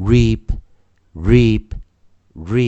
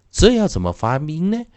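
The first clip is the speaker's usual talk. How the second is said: usual speaking voice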